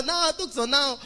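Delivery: normal talking voice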